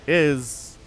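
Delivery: ordinary talk, nothing marked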